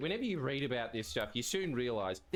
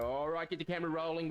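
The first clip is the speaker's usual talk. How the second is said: ordinary talk, nothing marked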